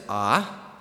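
rising ah, pitch climbing; ah sliding upward like a question; second tone. Shown as a demonstration. Teaching the class the rising second tone. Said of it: "The second tone rises".

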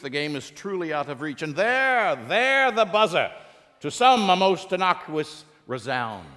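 theatrical Elizabethan sports commentary; Shakespearean play-by-play. Acted. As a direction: call the game like a Shakespeare actor. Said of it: "What would it sound like if William Shakespeare did the play-by-play".

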